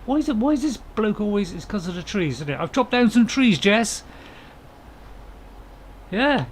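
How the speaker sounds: ordinary talk, nothing marked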